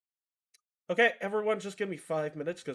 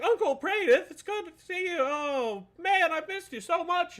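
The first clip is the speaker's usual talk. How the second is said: put-on much higher, gushing little-girl voice; girl's excited voice; 13 semitones higher, 4 dB louder than usual. The girl greets her long-lost uncle in a high, gushing, excited voice. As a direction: squeal it like a thrilled young girl. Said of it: "And she's like".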